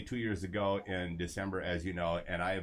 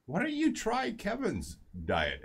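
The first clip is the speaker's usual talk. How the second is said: much higher, slow, put-on wife voice; needling wife; 12 semitones higher than usual. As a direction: tease the husband in a high sing-song. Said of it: "and, and she goes".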